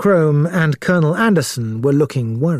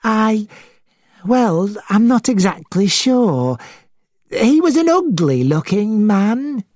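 lightly voiced witness, higher pitched; a hint of hesitation, pitched up; unsure witness; 8 semitones higher than usual. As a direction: search for the description in a higher voice. Witness describes the man.

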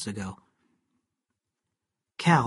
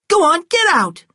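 much higher, loud shriek to leave; shrieking order; 10 dB louder than usual. A narrator's reading. Kate screams at Cal to leave, shrieking. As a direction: scream at him to go. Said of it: "she cried".

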